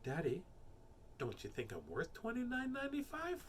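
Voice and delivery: soft little question rising high; quiet child. A narrator's reading. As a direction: ask softly in a small child's voice. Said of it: "quietly said".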